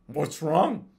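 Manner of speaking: a hint of a demonic, off-timbre voice. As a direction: ask it like something not human. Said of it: "real fucked up demon voice".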